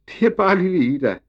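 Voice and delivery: put-on high, loud child's voice; gleeful boy. A narrator's reading. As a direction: pipe up like an amused little boy. Emil gleefully explains the hoisted flag.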